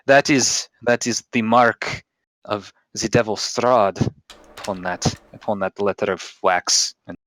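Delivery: put-on halting warning in a Russian accent. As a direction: deliver an ominous Russian-accented pronouncement. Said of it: "He winks at you in Russian".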